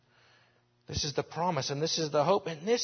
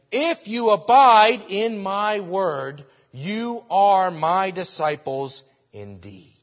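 slow, louder, every word weighted; slow emphatic quote; 5 semitones higher, 12 dB louder, much slower than usual. Acted. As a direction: lay down each word deliberately. Quoting Jesus, slow and emphatic.

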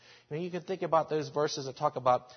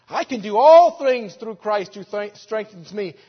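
loud, higher, declaring the verse; passionate proclamation; 6 semitones higher, 15 dB louder than usual. Acted. Quoting scripture with passion.

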